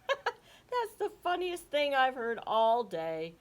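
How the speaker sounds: slow words through laughter; laughing. Acted. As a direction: chuckle the words out. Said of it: "Ollie shook with laughter".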